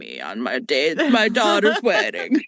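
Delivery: mob boss movie impression; mock-solemn crime boss holding court; Godfather. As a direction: put on a mafia don's voice. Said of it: "The entire beginning scene is him, like, sitting in his, you know, office".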